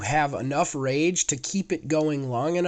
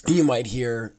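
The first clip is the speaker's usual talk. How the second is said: ordinary talk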